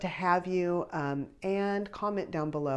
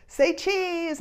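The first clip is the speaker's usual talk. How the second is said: cheery say cheese; 14 semitones higher, 4 dB louder than usual. Retold or imitated. Family posing for photo.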